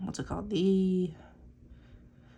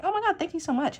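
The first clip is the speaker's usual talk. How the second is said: high, bright gushing thanks, louder; 10 semitones higher, 4 dB louder than usual. Retold or imitated. Imitating a flattered person gushing thanks. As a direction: squeal it like you're flattered. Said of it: "Some people will be like".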